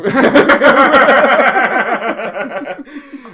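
long, high burst of laughter; loud laughter. Real, not acted. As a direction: crack up at the punchline. Laughing at own story.

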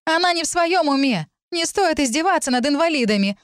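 high, scornful reproach; contemptuous. A narrator's reading. Narrator defending the girl, contemptuous. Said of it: "С презрением в голосе".

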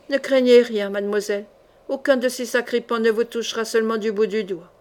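usual speaking voice

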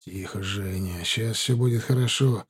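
soft, low calming voice; hushed reassurance. Acted. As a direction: soothe him quietly. Calming comrade while hiding.

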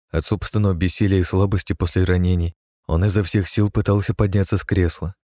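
plain reading voice

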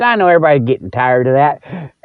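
usual speaking voice

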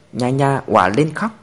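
ordinary delivery

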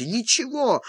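sobbing reply. A narrator's reading. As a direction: answer through sobs. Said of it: "всхлипывая, ответил Карпентер". (ответ со всхлипом)

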